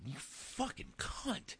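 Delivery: high, slow strained hiss; retold road rage curse. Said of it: "and I said".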